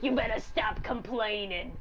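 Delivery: slow, scolding granny voice. Acted. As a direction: scold like a mean grandma. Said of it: "grandma's like".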